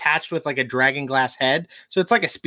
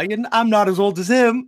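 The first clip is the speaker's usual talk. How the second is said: mock old man protest; 6 semitones higher, 4 dB louder than usual.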